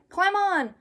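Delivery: high loud call. Acted. Stanley calling Arthur onto his back, loud call. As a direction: call out eagerly.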